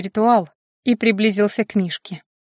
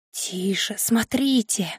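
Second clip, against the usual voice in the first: soft voice dripping with anticipation; quiet, expectant murmur; hushed anticipation; 6 dB quieter, slower than usual. A narrator's reading. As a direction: hush them, savouring what comes. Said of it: "В голосе Даркнесса сочилось предвкушение".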